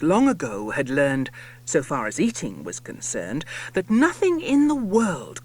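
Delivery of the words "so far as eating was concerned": plain reading voice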